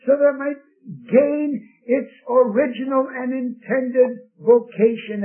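high, loud pulpit proclamation, building as it goes; impassioned preaching. Acted. Preacher expounding the eagle stirring its nest, impassioned and building. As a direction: preach it out with rising force and volume.

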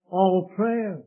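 ordinary talk

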